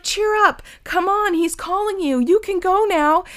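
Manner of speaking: high loud encouraging call; eager cheering. Acted. The crowd urging Bartimaeus toward Jesus, high and loud. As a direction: egg him on like an excited crowd.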